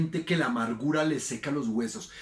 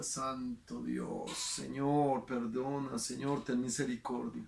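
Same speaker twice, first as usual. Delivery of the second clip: a slow, hushed plea to God, much softer; hushed prayer; 2 semitones lower, 8 dB quieter, slower than usual. Retold or imitated. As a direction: whisper it like a stunned prayer. Pastor's shocked prayer retold. (oración susurrada y lenta, mucho más suave)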